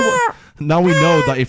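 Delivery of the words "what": squeaky high goose voice. Pretend. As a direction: voice a goose wondering. Geese imagined thinking aloud.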